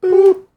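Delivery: high chirpy robot beeps; toy robot voice. Pretend. Randomizer picking a winner.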